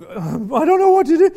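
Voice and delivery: exasperated quote, voice climbing; retold exasperation. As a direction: blurt it out as someone at a loss, acted. Acted quote of person in distress.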